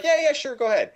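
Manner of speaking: high, breezy brush-off; mock-casual high dad voice; careless dad. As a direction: toss off the permission without a care. Dad casually waving them off.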